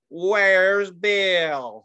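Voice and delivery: loud, drawn-out country twang; country accent. Shown as a demonstration. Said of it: "in a country accent".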